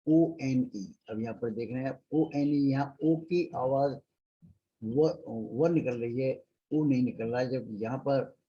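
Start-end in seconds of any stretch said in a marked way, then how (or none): none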